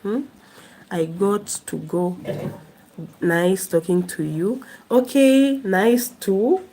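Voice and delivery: ordinary talk, nothing marked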